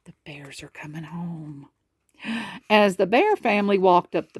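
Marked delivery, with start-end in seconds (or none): 0.0-1.7 hushed teaser whisper; breathy hushed opening. Acted. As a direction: whisper it like a secret.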